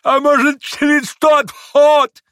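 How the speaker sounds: very high, loud, stretched squeal; shrill-pitched drawn-out yell; frenzied old man. A narrator's reading. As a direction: cry out a wild sudden hope. Frenzied old man shouts back.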